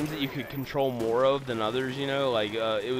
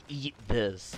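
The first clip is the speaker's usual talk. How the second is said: lightly voiced creepy offer; creepy friend; 3 semitones lower, 4 dB quieter than usual. Friend offers a bowl of cereal, slightly creepy voice. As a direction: say it in a hint of a creep's voice.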